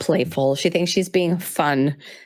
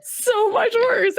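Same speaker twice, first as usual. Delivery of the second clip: laughing; much slower than usual. Real, not acted. Repeats while laughing hard.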